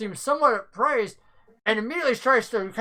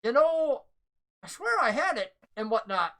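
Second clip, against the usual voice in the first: NPC voice; 5 semitones higher than usual. Acted. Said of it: "And he goes".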